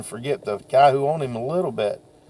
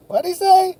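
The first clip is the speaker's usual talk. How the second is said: high squeaky voice of a confused listener; mock puzzled listener. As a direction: pop in as a puzzled bystander, much higher. Mock listener interrupting himself.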